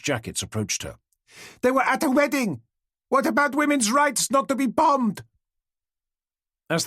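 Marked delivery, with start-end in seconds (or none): 1.6-5.2 high, loud protest; yelling heckler. Acted. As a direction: keep yelling while being dragged out. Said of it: "the woman could be heard yelling".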